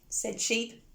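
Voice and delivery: usual speaking voice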